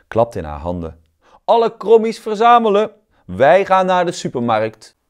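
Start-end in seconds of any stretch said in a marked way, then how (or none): 1.4-5.0 high, loud call to gather; rallying call. Acted. Tomato calls all the crooked vegetables together, high and loud. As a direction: round everyone up with a shout.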